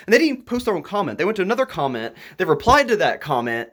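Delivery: usual speaking voice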